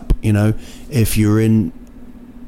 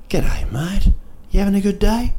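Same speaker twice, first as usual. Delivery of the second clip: high, rising cheery greeting; friendly shopkeeper; 10 semitones higher than usual. Retold or imitated. Someone in a New Zealand shop, imitated friendly greeting. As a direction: imitate a chirpy local, pitched right up. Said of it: "and someone will go".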